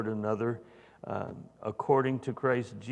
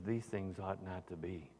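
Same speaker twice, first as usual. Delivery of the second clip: soft; 2 semitones lower, 8 dB quieter than usual. Acted. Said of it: "And he says".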